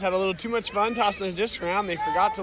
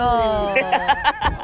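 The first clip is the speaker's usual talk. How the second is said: laughing; 4 semitones higher, 6 dB louder than usual. Real, not acted. Laughs at own joke.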